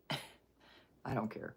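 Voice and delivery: slow whisper through a laugh. Real, not acted. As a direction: giggle it out, barely voiced. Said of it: "but I don't care".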